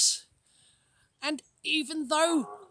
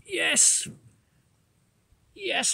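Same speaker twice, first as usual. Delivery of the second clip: normal talking voice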